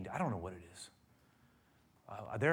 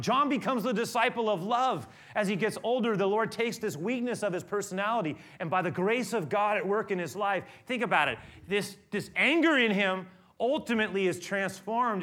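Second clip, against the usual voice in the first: loud, higher, climbing sermon voice; full-force preaching; 8 semitones higher, 8 dB louder than usual. Acted. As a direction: preach it out with rising fire.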